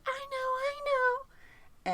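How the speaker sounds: put-on very high, slow little girl voice; eager little girl. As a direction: pipe it up like a tiny girl waving her hand. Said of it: "She said".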